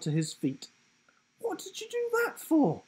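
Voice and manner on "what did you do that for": much higher, shocked question; indignant protest. A narrator's reading. Will protesting to the giraffe after the lick, high and shocked. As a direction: demand an answer, outraged.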